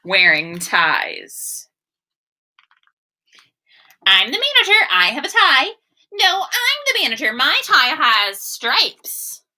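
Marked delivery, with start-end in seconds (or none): 4.0-5.8 much higher, louder little mouse voice; squeaky mouse manager. Acted. Mouse insisting to Bruce he is the manager, high and proud. 6.1-9.4 much higher, quick indignant squeak on "No, I'm the manager"; squeaky rival mouse. Acted. Second mouse arguing over who is manager, high and huffy.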